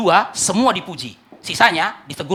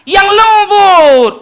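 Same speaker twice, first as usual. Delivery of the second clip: very loud, high, drawn-out yell; mock demanding shout; 17 dB louder, much slower than usual. Sleeping church demanding gentle preaching, mock bellowed demand. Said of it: "apa yang diminta oleh gereja yang tidur".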